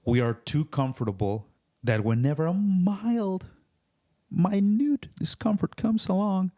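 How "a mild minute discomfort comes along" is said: exaggerated high-pitched affectation; put-on silly voice. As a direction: pitch climbing into a high mock-dainty voice. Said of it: "accent or voice was".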